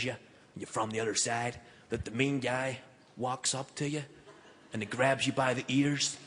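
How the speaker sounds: a touch of quiet American retail worker; shop guy. Acted. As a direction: ask softly as a curious store guy. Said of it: "he said".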